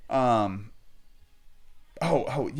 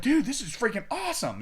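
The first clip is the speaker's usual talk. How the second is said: much higher, thrilled dude this is freaking awesome; excited; 12 semitones higher than usual. Retold or imitated. Telling the casting guy about the family connection, higher and thrilled. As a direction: burst out with delight. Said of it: "I'm excited".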